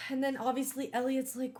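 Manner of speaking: normal talking voice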